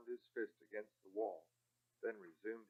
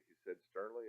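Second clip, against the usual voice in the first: usual speaking voice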